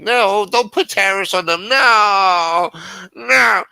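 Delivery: high, loud whining protest; mocking whiny Democrats. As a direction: mimic a whiny pleading liberal.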